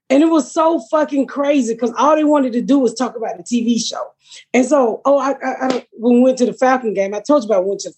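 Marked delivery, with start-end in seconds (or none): none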